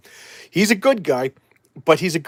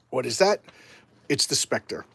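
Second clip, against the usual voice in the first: ordinary delivery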